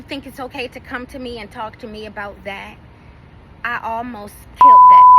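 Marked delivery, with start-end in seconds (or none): 3.6-5.2 screamed much higher and louder; real rage bursting out at the end of the story; furious outburst. Real, not acted. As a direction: explode at the top of your lungs. Furious climax of the rant.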